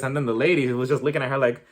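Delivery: normal talking voice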